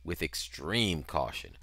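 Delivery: ordinary delivery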